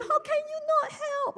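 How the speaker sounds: can't believe the spouse won't help; disbelief. Retold or imitated. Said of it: "or you're like, disbelief".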